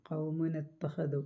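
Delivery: ഖൗമുന ഇത്തഖദു chanted slowly and softly; soft, slow tajweed reading; slow Quran recitation. Shown as a demonstration. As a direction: ease into a slow recitation, nun carried on its fatha. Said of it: "നൂനിന് ഫത്തായ മാത്രം".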